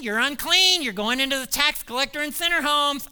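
pestering, scolding Pharisee; nagging Pharisee. Retold or imitated. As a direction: nag him like a fussy Pharisee. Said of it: "the problems that the Pharisees had".

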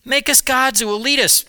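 Aaron mimicking the people's demand; Aaron mimicking the people's demand to Moses. A narrator's reading. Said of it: "They said to me".

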